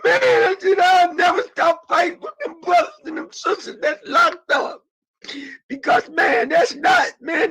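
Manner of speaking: crying out a promise never to stop fighting; passionate shout. Real, not acted. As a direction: roar the vow through tears. Vow to keep fighting for prisoners.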